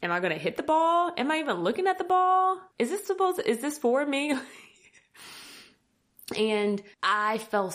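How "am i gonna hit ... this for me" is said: high, rushed self-doubting questions; mock panicked questioning. Beginner retelling her doubts on the court, high mock panic. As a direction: voice climbing as the doubts pile up.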